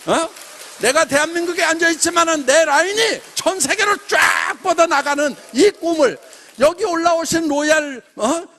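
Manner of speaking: loud and high, a rousing sermon-style pitch; rally the room like a revival preacher; preaching at full force to the crowd; fired-up preaching. Acted. Motivational speech, rising to a peak.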